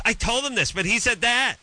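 high-pitched, whiny-caller imitation; mock protesting caller. Host mimics callers who argue back, mock protesting.

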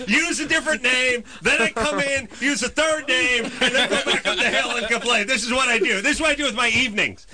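high-pitched, exasperated mock confession; sarcastic. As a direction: rant with incredulous sarcasm. Sarcastic rant at restaurant worker.

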